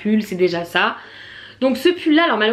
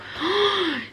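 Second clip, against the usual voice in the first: mock gasp; 10 semitones higher, 5 dB quieter than usual. Dismay at finding nothing.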